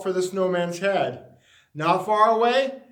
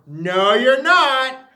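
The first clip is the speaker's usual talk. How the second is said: a slow, loud flat denial; frowning retort; 4 semitones higher, 6 dB louder, much slower than usual. A narrator's reading. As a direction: shut him down, slowly.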